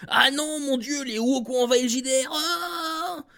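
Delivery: over-the-top high-pitched alarmed cry; mock panic. Mocks an outraged viewer reaction.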